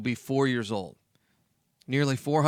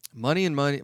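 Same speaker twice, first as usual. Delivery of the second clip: normal talking voice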